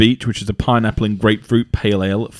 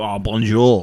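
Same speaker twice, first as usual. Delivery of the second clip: hammed French bonjour; slower than usual. Shown as a demonstration. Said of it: "You go".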